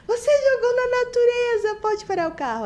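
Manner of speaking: high, loud mock child outcry; imitating indignant son. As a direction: cry out in a high kid voice, easing down at the end. Mãe imita filho indignado no carro. (imitando filho indignado, grito agudo)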